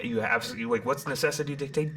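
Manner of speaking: ordinary talk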